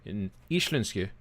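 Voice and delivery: "Íslensku" said the Icelandic way; Icelandic-style "Íslensku", opening on a long ee; native pronunciation. Shown as a demonstration. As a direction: pronounce "Íslensku" as an Icelander would. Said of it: "If I want to pronounce it".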